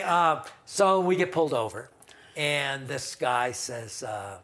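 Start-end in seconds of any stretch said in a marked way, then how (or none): none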